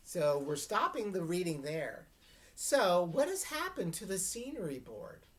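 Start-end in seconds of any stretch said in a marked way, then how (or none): none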